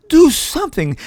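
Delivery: high, loud desperate plea; tearful begging. A narrator's reading. Mother pleading for someone to save her baby. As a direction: beg through tears. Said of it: "she begged with tears".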